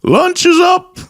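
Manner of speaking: very high sing-song call; granny calling out. Retold or imitated.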